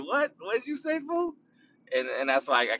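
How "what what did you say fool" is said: high, squeaky mock of a baffled listener; high comic voice, half-laughing; mocking confused listener. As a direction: play the listener who can't follow you.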